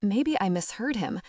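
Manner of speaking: plain reading voice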